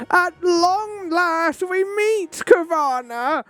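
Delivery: high, slow theatrical villain drawl; mock villain greeting. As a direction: ham up the grand nemesis line.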